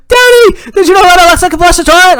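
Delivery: put-on high, loud little-kid squeal; loud, high-pitched child voice, rushing; excited daughter. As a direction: burst in with big news for Daddy. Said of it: "my daughter's like".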